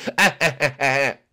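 staccato put-on chuckle; repeated cartoon laugh bursts; dad-joke laugh. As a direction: chuckle at your own pun. [name] laughs at own joke.

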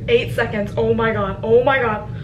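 loud last-second panic; panicky excitement. Real, not acted. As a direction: burst out as time runs out. Countdown to ticket sale, panicking.

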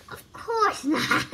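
slow words spoken through a laugh. A narrator's reading. Mavis answering Daphne's question, laughing it off. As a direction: laugh it off lightly. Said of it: "laughed Mavis".

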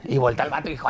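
ordinary talk

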